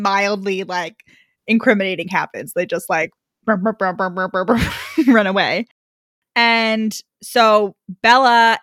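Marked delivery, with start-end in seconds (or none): 3.5-5.0 rapid burbled running sound; imitated scurrying noise. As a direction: make a cartoon noise of fleeing feet. Imitates characters scurrying away.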